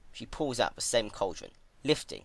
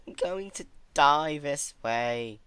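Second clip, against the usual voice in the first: slow, higher, tearful despair; soft crying; 7 semitones higher, 4 dB louder, slower than usual. Acted. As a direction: whimper through tears. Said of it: "crying softly".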